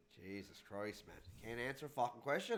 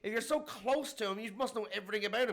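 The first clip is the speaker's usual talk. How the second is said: high, insistent mock interrogation; exasperated grilling; 8 semitones higher, 5 dB louder than usual. Questioning an official who claims close contact, exasperated grilling. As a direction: press for an answer, voice raised.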